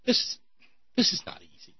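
"this is not easy" breathed out, almost cracking up; stifling a laugh. Real, not acted. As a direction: hold back a giggle on "this is not easy". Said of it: "not easy to say with a straight face".

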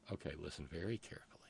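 hushed whisper so the clip can be heard. Real, not acted. Host whispering to the room before the clip. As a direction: lean in and whisper the cue.

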